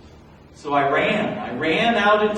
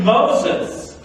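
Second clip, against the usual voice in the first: loud, higher call from afar; 7 semitones higher, 7 dB louder than usual. Acted. The voice from the bush calling Moses, loud and far. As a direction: call his name across the desert. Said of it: "I heard a voice call out my name".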